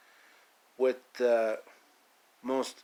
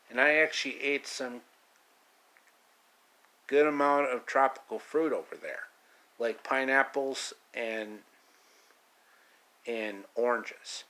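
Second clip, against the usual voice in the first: ordinary talk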